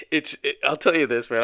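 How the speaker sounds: breaking into a laugh, then talking through it; real laughing. Real, not acted. Podcaster amused at his own habits, laughing. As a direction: start helplessly chuckling, push on into the words. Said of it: "I'm laughing because I'm thinking about my own habits".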